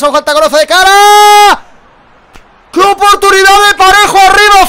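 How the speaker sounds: subiendo hasta un grito agudo en la ocasión; narración a gritos. Real, not acted. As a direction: grita la jugada como si fuera gol. Ocasión clara fallada, narrada en directo. (narración desatada, gritando con incredulidad)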